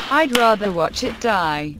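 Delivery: usual speaking voice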